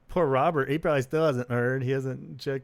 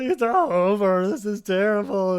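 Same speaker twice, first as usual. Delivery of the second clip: mock despair; 8 semitones higher, 7 dB louder than usual. Drunk fan mourning the band, hammed-up wail. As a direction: ham up the despair.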